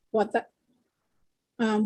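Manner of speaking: ordinary talk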